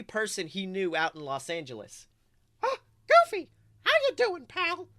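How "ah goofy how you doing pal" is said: very high excited cartoon mouse falsetto; Mickey Mouse. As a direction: squeak a loud, chummy greeting. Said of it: "Mickey Mouse's excited tone rang out".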